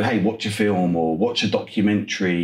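ordinary talk

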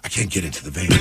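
low, quiet, scared; whisper-quiet wary refusal; quiet paranoid wife. Retold or imitated. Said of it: "She's like".

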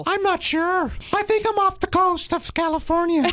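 cruise lady. Pretend. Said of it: "you're gonna pretend to be her".

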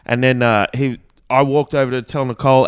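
ordinary delivery

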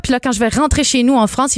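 usual speaking voice

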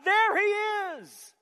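loud, high shout, slowing on the last words. Acted. Preacher acting John pointing out Jesus, shouting. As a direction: holler it out to grab the crowd. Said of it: "It's like he was shouting".

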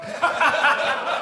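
high breathy laugh. Real, not acted. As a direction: burst out laughing. Laughing at his own story.

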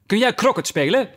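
high, imperious royal question; called-out question. A narrator's reading. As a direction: call it out, pitched well up. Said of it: "riep de koningin". (hoog uitgeroepen vraag)

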